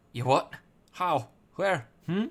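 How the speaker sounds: loud, cheeky Welsh sidekick; Welsh Drippy voice. Acted. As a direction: bellow it like a brash little Welsh fairy.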